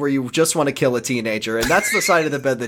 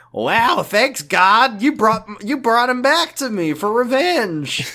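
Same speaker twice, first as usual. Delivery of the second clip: gleeful vengeful voice; 8 semitones higher, slower than usual. Retold or imitated. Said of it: "'cause he's like".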